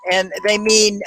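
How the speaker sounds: usual speaking voice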